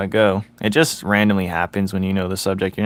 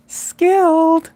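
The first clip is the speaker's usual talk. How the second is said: high voice; 6 dB louder than usual. Pretend. Dramatic word drawn out.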